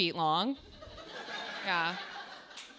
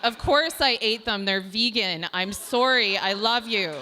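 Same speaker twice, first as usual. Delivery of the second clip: louder, insistent mock apology; mock pleading; 2 semitones higher, 6 dB louder than usual. Girlfriend defends herself over eaten chips, mock pleading. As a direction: plead it out loudly, hammed-up.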